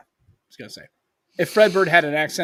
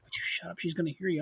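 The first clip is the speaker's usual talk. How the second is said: a retold, softer telling-off from his wife; hushed scolding; 12 dB quieter than usual. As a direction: hush him so the lady won't hear. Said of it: "and Becky's like".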